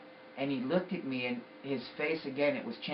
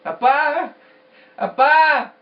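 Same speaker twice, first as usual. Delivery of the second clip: high, very loud cry for dad; long, desperate-sounding shout; screaming out; 13 semitones higher, 17 dB louder, slower than usual. Retold or imitated. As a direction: scream out after a father who is vanishing. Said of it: "I was screaming out for him".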